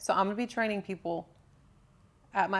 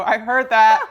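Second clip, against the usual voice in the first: loud mock holler; called from another room; 2 semitones higher, 16 dB louder, slower than usual. Friend calls out from the other room, mock shouting. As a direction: shout it as if through a wall. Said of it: "hear from the other room go".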